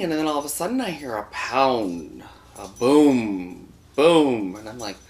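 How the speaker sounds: ordinary delivery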